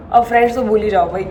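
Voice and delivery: normal talking voice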